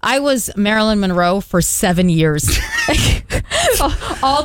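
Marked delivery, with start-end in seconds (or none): 2.5-4.2 high shrieking laugh. Real, not acted. Reacts to seven years of costume.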